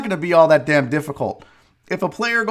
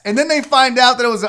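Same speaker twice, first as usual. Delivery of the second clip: normal talking voice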